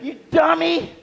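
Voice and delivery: high, slow mocking kid yell; yelling gamer kid. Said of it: "It was like".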